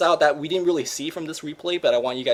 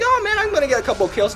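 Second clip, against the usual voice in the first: excited quote; 12 semitones higher, 5 dB louder, faster than usual. Retold or imitated. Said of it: "You're like".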